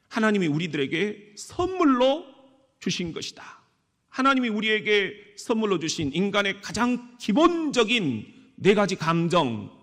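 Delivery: normal talking voice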